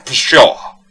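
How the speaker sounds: loud scornful exclamation; a loud scoffing cry of impatience; cried out. A narrator's reading. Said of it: "cried the old squire".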